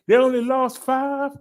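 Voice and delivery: high and loud, celebrating; cheerful catchphrase. Acted. Celebrating low death count.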